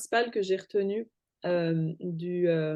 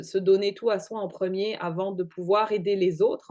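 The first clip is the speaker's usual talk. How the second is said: ordinary talk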